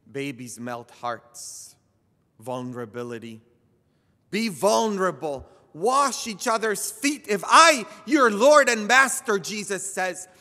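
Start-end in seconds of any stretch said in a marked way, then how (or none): 4.3-10.3 preaching at full force. Acted. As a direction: lift the congregation with each phrase.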